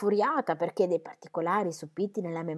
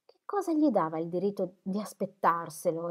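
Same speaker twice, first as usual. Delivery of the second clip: plain reading voice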